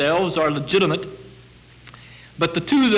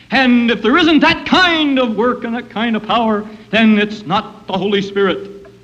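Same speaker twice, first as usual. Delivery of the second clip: higher and louder, pushing the point home; forceful preaching; 6 semitones higher, 6 dB louder than usual. Real, not acted. Preacher pressing his point.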